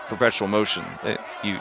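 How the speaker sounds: normal talking voice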